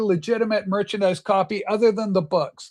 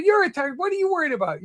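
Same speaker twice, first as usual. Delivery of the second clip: put-on eager kid; 7 semitones higher, much faster than usual. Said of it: "He goes".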